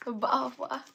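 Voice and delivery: put-on British accent with dropped t's; mock British glottal stops. Shown as a demonstration. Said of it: "would you like me to speak like this".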